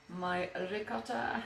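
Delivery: a hint of Italian accent on the word, a little slow; lightly Italian-flavoured "ricotta"; slight Italian accent. Cook naming cheese, a touch of Italian accent. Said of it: "That was not an attempt at an Italian accent".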